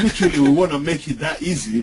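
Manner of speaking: amused, quick, laughing aside. Real, not acted. Webinar host jokes about making it easy, laughing. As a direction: say it through a laugh.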